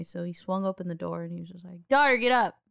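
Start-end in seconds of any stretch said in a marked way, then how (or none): 1.9-2.6 retold wake-up call from a loud dad. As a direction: imitate a loud father calling, higher and louder. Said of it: "my dad's a loud guy".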